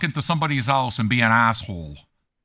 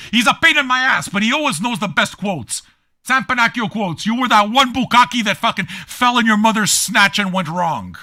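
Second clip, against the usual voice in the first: normal talking voice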